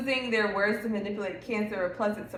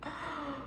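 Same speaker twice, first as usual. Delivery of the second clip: sharp gasp; 6 semitones higher, 15 dB quieter than usual. Real, not acted. Reacting to ex's behaviour.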